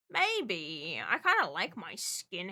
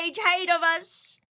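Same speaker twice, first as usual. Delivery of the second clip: mock accusation; 6 semitones higher, 7 dB louder than usual.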